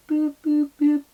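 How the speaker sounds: high, loud imitated phone beeps; dial-tone beeps. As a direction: mimic a disconnected line on one high note. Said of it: "You know when it goes".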